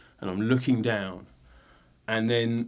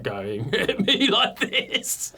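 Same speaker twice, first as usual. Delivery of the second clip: slow, high mocking laugh; 9 semitones higher, much slower than usual. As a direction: laugh down at him slowly. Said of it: "he's just, like".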